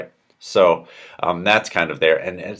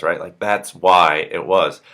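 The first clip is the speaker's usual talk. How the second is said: ordinary talk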